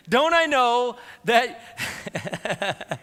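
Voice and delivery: loud, high, drawn-out indignation; angry king. Acted. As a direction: stretch it out in acted outrage. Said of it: "Saul's anger flared up at Jonathan".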